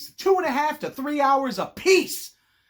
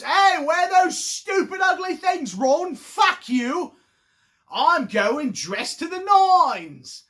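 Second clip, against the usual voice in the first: slow, higher, louder sneer at Ron; nasty Harry; 5 semitones higher, 6 dB louder, much slower than usual. Retold or imitated. Mean Harry mocks Ron's dress robes, slow loud higher sneer. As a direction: bark the insult slowly down at him. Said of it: "He goes".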